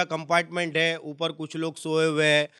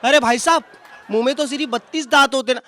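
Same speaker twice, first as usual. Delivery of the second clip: higher, louder, quick correction; helpful passerby; 6 semitones higher, 8 dB louder, faster than usual. Retold or imitated. Passerby points out only thirty-two teeth, quick and loud. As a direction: chime in like a know-it-all passerby.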